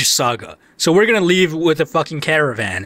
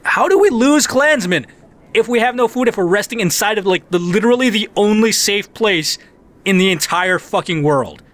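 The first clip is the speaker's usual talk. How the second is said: incredulous rant; 6 semitones higher than usual. Real, not acted. Indignant rant at the game.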